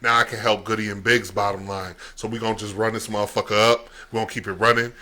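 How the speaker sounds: normal talking voice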